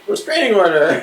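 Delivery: high, loud mock outburst; mock alarmed call. Teacher fending off a persistent student, mock alarmed call.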